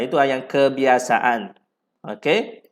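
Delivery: ordinary delivery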